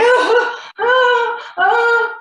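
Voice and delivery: imitated loud, high crying wail; Lance. Wife imitating her husband crying out loud, high wailing. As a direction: wail "oh" loudly in a high mock cry. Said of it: "I can hear Lance crying, like, out loud, super loud".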